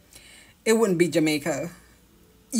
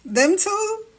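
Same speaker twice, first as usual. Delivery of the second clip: high exclamation; 12 semitones higher than usual. Real, not acted. Exasperated amused exclamation.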